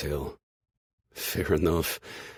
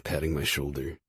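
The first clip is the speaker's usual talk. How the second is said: ordinary delivery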